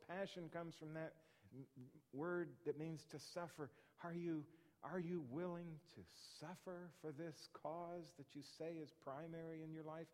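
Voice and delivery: ordinary talk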